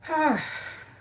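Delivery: high sighing breath of grief; heavy sigh. Real, not acted. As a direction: let a long sad sigh out. Grief after missing him.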